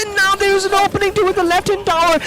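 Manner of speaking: high, loud rising hype; excited. Real, not acted. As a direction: cast the comeback breathlessly. Comeback unfolding live.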